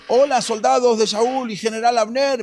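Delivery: high, loud hailing call; acted shout. David shouts from the hill to Saúl's soldiers, loud hail. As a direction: call out to wake a sleeping camp. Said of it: "empezó a gritar, ¿quién? Eh, fuerte".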